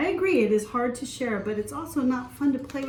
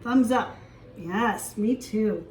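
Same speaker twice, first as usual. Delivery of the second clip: ordinary delivery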